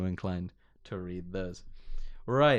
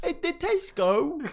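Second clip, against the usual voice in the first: high, slow British caricature; mock British accent; slower than usual. Co-host riffing on British clichés, high slow mock accent. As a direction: drag it out high in silly British, laughing. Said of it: "That's exactly how we sound".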